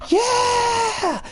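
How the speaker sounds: high gleeful yell; joyful shout. Retold or imitated. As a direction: shriek for joy. Jumping for joy at murder.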